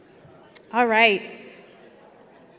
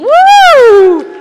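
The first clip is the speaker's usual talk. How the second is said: excited cheer; 12 semitones higher, 14 dB louder than usual. Real, not acted. Cheering at end of auction round.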